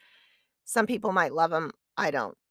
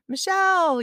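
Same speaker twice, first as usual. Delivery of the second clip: imitated calling out; 13 semitones higher, 6 dB louder than usual. Friends spotting the runner from the roadside, calling out. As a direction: call out like a friend from the sidelines.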